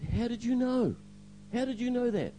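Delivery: high-pitched, amazed retold question; astonished. Young man asks pastor how he knows, astonished. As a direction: ask in stunned disbelief, pitch way up. Jaw hit the floor.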